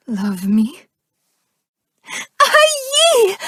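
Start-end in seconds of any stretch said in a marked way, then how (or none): 2.4-3.4 very high, shouted cry of delight; joyful shriek. Acted. Hearing her love says yes, shrieking with joy. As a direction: squeal with overwhelming joy.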